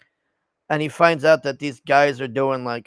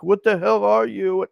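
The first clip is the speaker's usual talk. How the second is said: higher, mock-tearful plea; lightly acted tearful disbelief; almost crying; 7 semitones higher, 3 dB louder than usual. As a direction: imitate a man close to tears. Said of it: "he's, like, almost crying".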